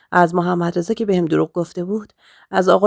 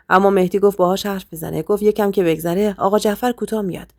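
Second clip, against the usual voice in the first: plain reading voice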